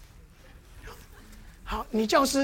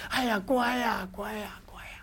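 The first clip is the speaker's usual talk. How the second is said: put-on hushed whisper, like settling a baby; whispered soothing; 2 semitones lower, 6 dB quieter than usual. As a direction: breathe the words softly to a crying infant. Pastor soothing a crying baby.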